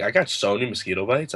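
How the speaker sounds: ordinary delivery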